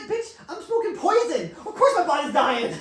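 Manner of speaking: high, loud protest while laughing; loud laughing retort to a doubting wife; exasperated outburst. Retold or imitated. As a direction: shout it back at the wife, cracking up. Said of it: "I'm like".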